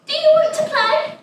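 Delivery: high, bright coaxing voice of a teacher. Retold or imitated. Teacher coaxing a shy girl to play, high and gentle. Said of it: "and says".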